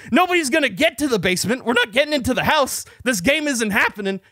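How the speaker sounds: high-pitched heated rant; retold yelling back. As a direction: act out yelling back in an argument. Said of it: "I'm yelling back at him".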